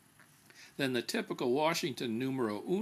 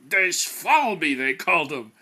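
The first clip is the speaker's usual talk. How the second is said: put-on slow, loud German accent with a laugh in it; mock German accent, relishing it; 3 semitones higher, 10 dB louder, slower than usual. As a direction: savour the German word in a German accent. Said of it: "and a pretty good German accent".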